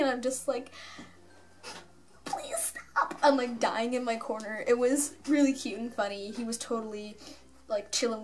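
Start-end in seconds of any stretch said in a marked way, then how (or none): 2.3-3.1 tiny, very high squeaked plea; mortified plea. Retold or imitated. Embarrassed fan dying in the corner, high squeak. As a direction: squeak it out, half laughing with embarrassment. Mortified at being yelled about.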